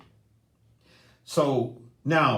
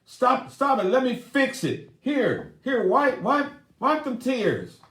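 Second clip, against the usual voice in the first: flustered husband; 10 semitones higher than usual. Retold or imitated. Husband to crying wife, high flustered fussing. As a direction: scramble to fix her tears. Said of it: "And he's like".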